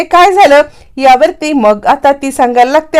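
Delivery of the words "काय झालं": raised, higher call across the house; a mock shout from another room; calling out loudly. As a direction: call out to her sister. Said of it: "कला आता जोरात आवाज देते".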